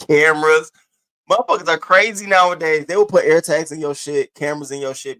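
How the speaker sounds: high, loud, laughing outburst; laughing rant. Real, not acted.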